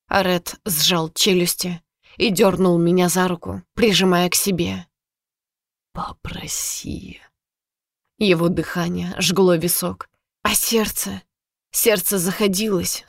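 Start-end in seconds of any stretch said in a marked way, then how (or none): 5.9-7.2 slow, breathy command; commanding whisper. Acted. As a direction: whisper the order against her temple. Villain whispers demand at temple.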